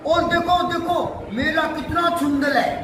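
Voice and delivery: put-on a little kid calling out, thrilled with his toy; excited lisping child. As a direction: Munnu excitedly showing off his toy with a lisp. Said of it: "तुतला कर".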